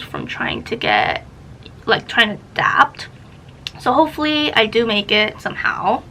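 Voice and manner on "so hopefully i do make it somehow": usual speaking voice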